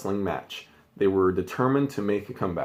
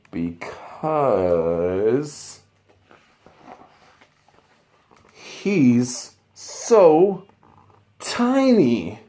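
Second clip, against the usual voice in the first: dramatic reveal; 2 semitones higher, 3 dB louder, much slower than usual. Acted. Dramatic reveal of tiny wrestler.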